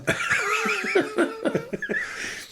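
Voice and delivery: long high laugh. Real, not acted. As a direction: laugh it off freely. Laughing at interviewer's gasp.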